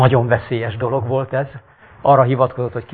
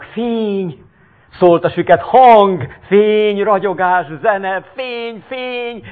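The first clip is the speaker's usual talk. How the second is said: crying for joy; 9 semitones higher, 3 dB louder, slower than usual. Acted. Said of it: "sírta a vak".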